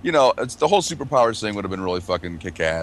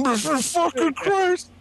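very high, drawn-out comic outcry; mock overreaction; much slower than usual. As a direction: imitate a movie character freaking out, slowly. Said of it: "that dude overreacted".

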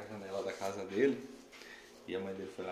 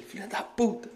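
retold yell; 9 semitones higher, 6 dB louder than usual. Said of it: "ele xingou a mãe dele".

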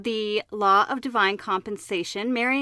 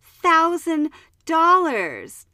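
daughter complaining; 7 semitones higher, 5 dB louder, slower than usual. Retold or imitated. Daughter voiced complaining to father.

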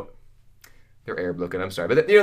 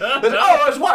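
laughing mock realization; 11 semitones higher, 7 dB louder, slower than usual.

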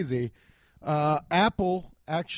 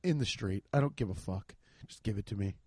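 low, soft couldn't-care-less; careless; 7 semitones lower, 5 dB quieter than usual. Retold or imitated. Speaker telling the salesman he doesn't care, low and offhand. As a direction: shrug it off, just hand it over. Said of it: "And I was like".

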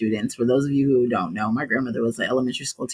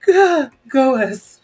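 slow, high, loud laughing cheer; after laughing hard; 10 semitones higher, 6 dB louder, much slower than usual. Real, not acted. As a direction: cheer weakly through laughter. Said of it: "Okay, my cheeks hurt".

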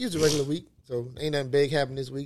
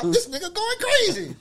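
mock panic. Mock panic over gunfire.

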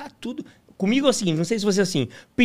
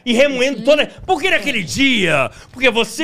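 mock nagging; 6 semitones higher, 6 dB louder than usual. Acting out a grudge-holding partner.

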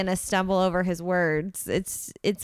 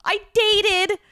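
high, loud, frantic confession; mock freak-out; 9 semitones higher, 7 dB louder than usual. Contestant confessing to Joe about the elephant in the room, mock frantic. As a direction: blurt it out in a hammed-up panic. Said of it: "She's freaking out".

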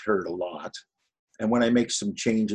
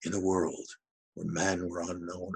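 lightly imitated movie-trailer voice; hint of an epic trailer narrator; Don LaFontaine; 2 semitones lower, 5 dB quieter than usual. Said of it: "he starts every sentence in a certain way".

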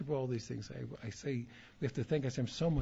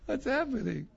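much higher, louder laughing question; imitating the Rebbe; 11 semitones higher, 6 dB louder than usual. The Rebbe asks the grinning student, with a high laughing voice. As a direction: ask it like a bemused teacher through laughter. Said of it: "He says to me".

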